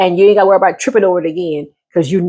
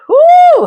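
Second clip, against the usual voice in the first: loud, high-pitched "woo"; high whoop; 7 dB louder than usual. Real, not acted. Exclaims over being upset.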